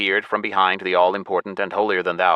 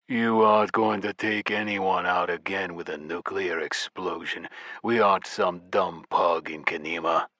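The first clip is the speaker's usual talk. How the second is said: growling giant; 5 dB quieter than usual. A narrator's reading. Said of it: "growled in my face".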